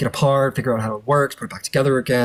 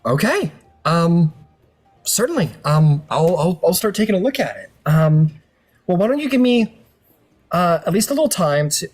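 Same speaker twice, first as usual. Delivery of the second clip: put-on lightly raised, eager young tinkerer voice; nervous, keen to help; eager tiefling; 6 semitones higher than usual. Tiefling NPC to the Gith warrior, eager lightly raised voice.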